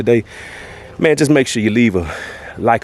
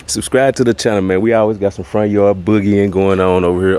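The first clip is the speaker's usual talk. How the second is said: ordinary talk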